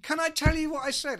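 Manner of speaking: high, loud stage shout; shouting to crowd. Acted. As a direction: bellow it at a concert crowd.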